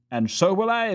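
usual speaking voice

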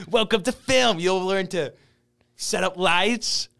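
high, put-on welcoming announcer voice; mock celebrity shout-out. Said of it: "buy it for, like, our film teacher".

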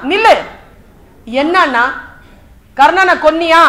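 acted confrontational questioning; sharp challenge. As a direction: halt him and demand an answer. Dharman halts and challenges Arjuna.

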